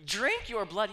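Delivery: high, loud insistence; emphatic demand. Retold or imitated. Jesus pressing the crowd, high and loud. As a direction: push the words hard and loud.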